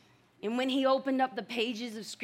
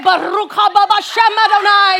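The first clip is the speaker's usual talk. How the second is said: much louder, higher Hebrew blessing; joyful shout; 6 semitones higher, 14 dB louder than usual. Retold or imitated. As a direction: proclaim it to husband in pure joy. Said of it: "I turned to my husband and I said".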